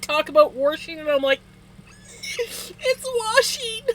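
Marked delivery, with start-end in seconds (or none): 2.4-3.8 high, drawn-out exasperated correction; imitating yourself driven nuts; mock exasperation. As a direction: whine it slowly, way up high. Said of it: "Used to drive me nuts".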